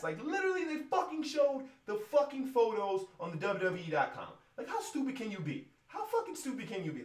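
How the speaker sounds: high, loud furious ranting. Real, not acted. Fan ranting at WWE commentary, loud and furious. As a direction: rage at the stupidity.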